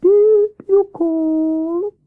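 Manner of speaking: high, long-drawn hooting owl voice; cool Wise Owl. Acted. Owl answers Hare's calling, cool and drawn-out. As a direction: ask coolly, stretching each vowel. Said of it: "he asked coolly".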